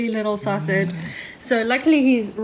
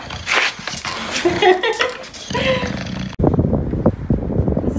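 breathy laugh. Real, not acted. Laughing at the pig.

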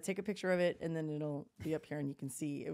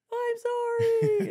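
very high, loud, drawn-out mock wail; mimicked crying child; 12 dB louder, much slower than usual. Caught toddler apologizing to mom, high loud mock bawling. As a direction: wail it like a toddler bursting into tears. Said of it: "and just started crying".

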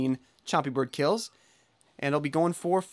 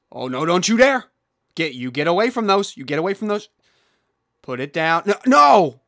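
scolding shout; 7 dB louder than usual. Real, not acted. Scolding the pet over a mushroom.

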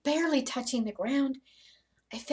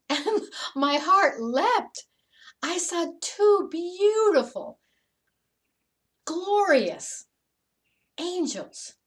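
awestruck joy; 6 semitones higher, 6 dB louder, slower than usual. Acted. Heart leapt at seeing angels.

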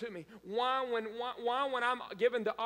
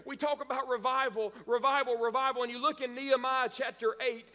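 ordinary delivery

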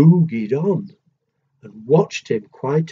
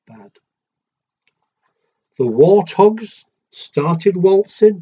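gentle rhythmic read-aloud; storybook reading; 3 semitones lower than usual. A narrator's reading. Parent reading a picture book to a child, sing-song rhyme.

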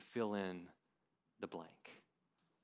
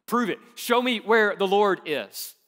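loud challenge; 6 semitones higher, 11 dB louder than usual. Acted. Gideon challenges the Lord.

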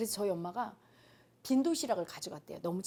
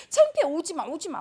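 loud whiny outburst at mom; child's tantrum; 5 semitones higher, 7 dB louder than usual. Retold or imitated. Daughter yelling at her mother to stay away from school, tantrum. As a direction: shout it at your mother, ashamed of her. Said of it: "막 이랬어요".

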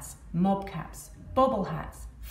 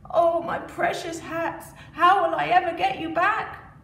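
higher wailing lament; crying; 9 semitones higher, 5 dB louder than usual. Acted. Sad hat seller crying into his hands, high wailing. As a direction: wail over the lost hats. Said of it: "he began to cry".

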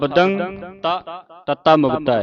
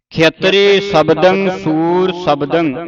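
slow recitation; 2 semitones higher, slower than usual. Shown as a demonstration. Teacher repeating the line slowly for pupils. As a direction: chant it slowly and clearly. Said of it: "ਇੱਥੇ ਵਿਸਰਾਮ ਲੱਗ ਜਾਣਾ ਹੈ".

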